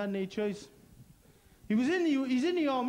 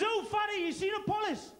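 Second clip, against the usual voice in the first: mugger voice; 9 semitones higher than usual. Retold or imitated. Attacker quoted high.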